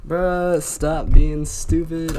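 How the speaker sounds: loud, slow, high scolding shout; angry yell. Real, not acted. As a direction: yell it at yourself. Angry at own mistakes.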